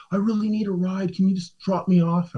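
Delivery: high-pitched mock pleading; pleading woman.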